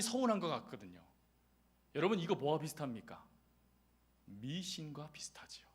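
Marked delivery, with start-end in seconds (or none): 4.3-5.7 slow confiding whisper. Real, not acted. Preacher whispering the verdict to the congregation. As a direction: whisper it like a secret. Aside comparing faith to superstition.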